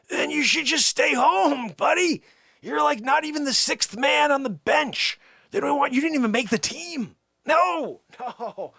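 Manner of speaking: high-pitched, exasperated comic tirade; mock scolding.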